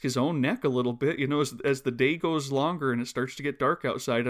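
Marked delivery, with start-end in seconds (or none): none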